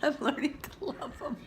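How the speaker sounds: said through a small laugh. Real, not acted. Vlogger repeating her line to viewers, now laughing. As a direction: laugh it off lightly.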